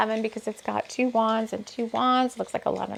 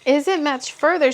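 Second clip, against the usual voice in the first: higher, louder impatient ask; eager question; 5 semitones higher, 6 dB louder than usual. Acted. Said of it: "she asked".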